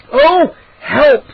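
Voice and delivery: high, loud scared cry; frightened cry. A narrator's reading. Boy crying out as creatures snap in the dark. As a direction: cry out in fear. Creatures biting his ankle.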